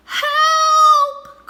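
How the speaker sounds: very high, very loud scream for help; panicked cry for help. A narrator's reading. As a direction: yell for help while rolling away. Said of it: "cried Armadillo".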